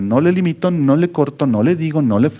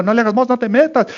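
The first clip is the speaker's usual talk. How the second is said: mock scolding wife; 9 semitones higher than usual. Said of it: "y yo como".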